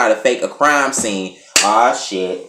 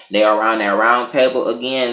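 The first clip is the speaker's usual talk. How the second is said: normal talking voice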